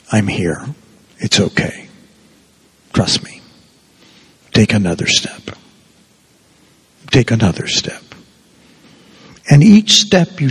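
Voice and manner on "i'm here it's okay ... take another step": soft, low and half-whispered reassurance; hushed, tender encouragement; gentle voice. Retold or imitated. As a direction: coax them forward in the dark, softly. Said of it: "you hear a very gentle voice".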